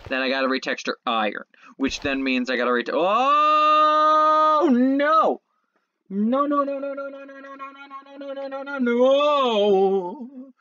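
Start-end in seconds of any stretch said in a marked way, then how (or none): 2.9-10.5 much higher rapid string of no's, fading out; panicked no. Real, not acted. Player watching something go wrong, higher panicked pleading. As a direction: beg the game not to let it happen. Panicking during risky mining.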